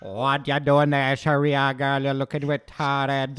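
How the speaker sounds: a bad, hammed-up Jamaican accent, slowed at the end; playful, clumsy Jamaican mother impression; mock Jamaican accent. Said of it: "In a Jamaican accent?".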